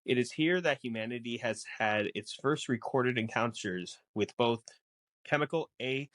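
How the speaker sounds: ordinary delivery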